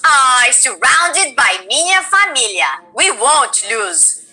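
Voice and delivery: slow, Portuguese-flavoured character line; Brazilian accent. Acted. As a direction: savor "minha familia" in Brazilian Portuguese. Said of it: "she goes".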